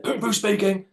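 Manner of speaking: lightly voiced stern old man answering; a hint of a gruff husband; grumpy husband. Husband answering the prank caller, stiff and formal.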